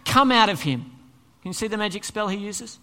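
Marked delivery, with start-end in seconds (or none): 0.1-0.8 loud, firm order; stern, forceful rebuke. Acted. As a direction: command it out with authority. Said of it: "said Jesus sternly".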